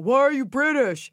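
high mock heckling yell; retold yell. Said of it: "this guy yells out".

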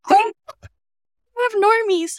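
high, giddy mock celebrity voice; mock diva. Boasting about her little fan club, high giddy mock diva. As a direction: gush like a star with a fan club. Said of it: "It's like".

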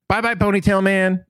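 put-on high-pitched child's call, lightly shouted; mock yell. Recapper voicing Iki as Tarlok exits, mock yell. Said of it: "Iki yells as Tarlok exits".

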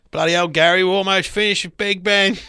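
Cockney builder. Retold or imitated. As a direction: shout it like a Cockney builder on scaffolding. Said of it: "Be some bloke up on scaffolding".

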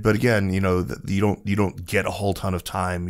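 ordinary talk, nothing marked

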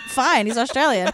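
mock Australian accent. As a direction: say it like an Aussie. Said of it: "and I'm like".

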